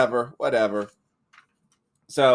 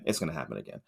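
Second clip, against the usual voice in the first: quiet aside; 8 semitones lower, 14 dB quieter, much faster than usual. Real, not acted. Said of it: "it won't happen again".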